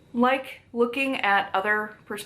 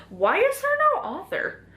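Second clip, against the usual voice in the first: dismayed question; 13 semitones higher than usual. Real, not acted. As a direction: pitch leaps up in baffled protest. Dismayed to find only editors.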